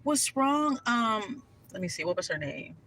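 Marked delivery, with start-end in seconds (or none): 0.0-0.8 higher, sugary fake sympathy; mock concern. The narrator mocking the concern she never showed, fake sweet. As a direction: pretend to care, sweetly. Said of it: "You think I would be like".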